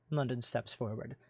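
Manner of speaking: usual speaking voice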